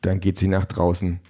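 plain reading voice

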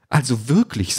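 high, affronted exclamation; indignant drone. A narrator's reading. Drone reacts to Peter's threat, indignant. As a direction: sound scandalised as a prim robot. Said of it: "Ihr Verhalten ist empörend".

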